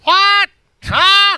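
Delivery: loud, very high squawk of a man who can't hear; high-pitched shout, as if hard of hearing; Bubba. Acted. Podcast guest mimics his hard-of-hearing buddy, high-pitched shout. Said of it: "he's got a real high-pitched voice".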